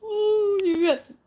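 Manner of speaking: high, loud laughing exclamation. Real, not acted. Laughing after the funny moment.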